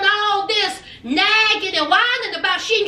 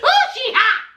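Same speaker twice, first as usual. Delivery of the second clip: excited; 6 semitones higher than usual. Real, not acted. Reacting to a heated TV moment.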